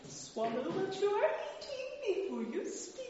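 a touch of the prim mother correcting manners; gently chide, high and proper; mild scolding. Acted. Storybook mother correcting the prince's table manners, prim.

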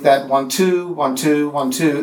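chanted one-two beat, weak then strong; counting da-DUM da-DUM; iambic beat. Shown as a demonstration. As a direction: tap out the iambic rhythm.

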